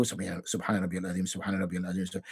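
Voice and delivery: soft, quick murmured recitation; mumbled tasbih. Retold or imitated. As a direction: mumble the praise under your breath, quickly. Imitating people praying ruku.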